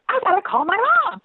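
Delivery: high-pitched excited squeal; freaking out, retold. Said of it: "And I was freaking out".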